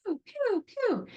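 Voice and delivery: high, playful pew pew sound effects; mock sci-fi pew pew; firefighter blaster noises. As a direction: zap like toy laser guns. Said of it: "They're like".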